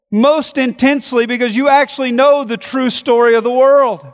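high and loud, driving sermon delivery; preaching at full force. Acted. Pastor to congregation on God's sovereignty, full-force preaching. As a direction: preach it out hard to the whole room.

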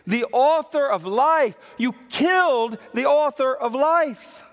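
high, ringing, emphatic preaching; forceful accusation. Real, not acted. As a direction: hammer it home to the congregation. Repeats verse as forceful accusation.

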